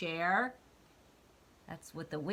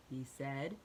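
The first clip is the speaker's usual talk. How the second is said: ordinary delivery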